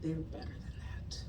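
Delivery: slow, low warning sinking to near-whisper; measured dramatic monologue, deep and very soft; Viola Davis monologue moment. Retold or imitated. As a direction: deliver a grave, theatrical hushed threat. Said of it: "my grandma didn't talk like that".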